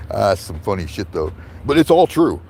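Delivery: plain reading voice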